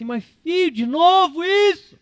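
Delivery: high, pleading father scolding a stubborn son; exasperated father. Retold or imitated. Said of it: "ia olhar pro Jonas e dizer assim".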